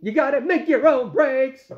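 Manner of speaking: big, high-pitched mock rocker proclamation. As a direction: belt it out like a slogan. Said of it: "like the great [name] says".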